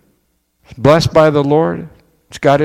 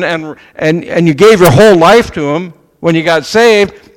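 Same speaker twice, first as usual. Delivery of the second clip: louder, higher, pressing the point hard; forceful preaching; 4 semitones higher, 6 dB louder than usual. Real, not acted. Preacher confronting the congregation about passion, loud and urgent. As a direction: build into a full-voiced challenge.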